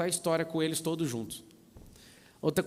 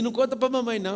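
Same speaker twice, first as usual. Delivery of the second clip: higher, louder imitated kid voice; pleading child; 7 semitones higher, 6 dB louder, faster than usual. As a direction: plead urgently like a kid with a secret. Child begging secrecy, acted. (criança suplicando, voz aguda)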